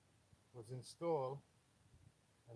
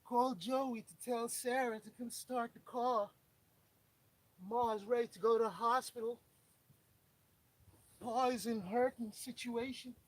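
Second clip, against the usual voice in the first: put-on southern accent; 10 semitones higher, 8 dB louder than usual. Mechanic mock-alerting family in Southern accent. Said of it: "That's my southern accent".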